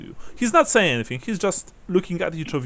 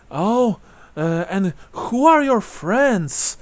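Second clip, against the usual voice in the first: put-on high, slow old-lady inquiry; elderly woman's lilting question; curious old woman; 8 semitones higher, 4 dB louder, slower than usual. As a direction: ask high and drawn out like an old widow. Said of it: "and she looks at Gustav".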